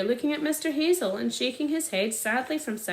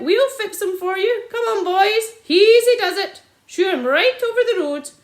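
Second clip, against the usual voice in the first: high, loud eager shouting; cheerful loud call; 8 semitones higher, 10 dB louder than usual. A narrator's reading. Sergeant loudly urging the boys on. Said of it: "cried out".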